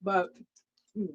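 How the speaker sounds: talking while eating dinner; mouth full. Real, not acted. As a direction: speak through a mouthful of food. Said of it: "Sorry, I'm actually eating my dinner".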